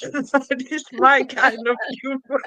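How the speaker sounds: giggling through the words; laughing. Real, not acted. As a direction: laugh out the line. Laughing at her own joke.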